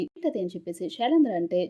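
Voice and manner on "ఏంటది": a sudden high, puzzled "what is it?"; surprised question. Acted. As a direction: jump way up in surprise. Puzzled husband asks what remedy. (భర్త ఆశ్చర్యంగా, బాగా ఎత్తైన స్వరంతో అడుగుతాడు)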